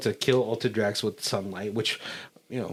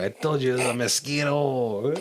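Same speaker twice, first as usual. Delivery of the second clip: ordinary talk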